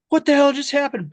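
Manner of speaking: high, loud what-the-hell yell; jolted into shouting; startled yell. Retold or imitated. Director of photography yelling as the dolly crashes, startled and loud.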